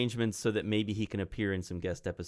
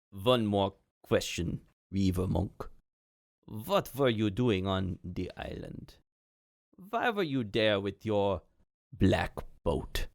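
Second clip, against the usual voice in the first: put-on slow, accented interrogator; Yaromir; slower than usual. Yaromir stops the monk with a question, slow and accented. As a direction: question slowly in a thick accent, word by word.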